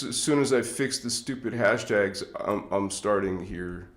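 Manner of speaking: ordinary talk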